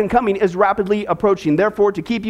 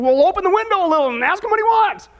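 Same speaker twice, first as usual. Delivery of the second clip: urgent acted order; 7 semitones higher than usual. Acted panicky exchange in joke.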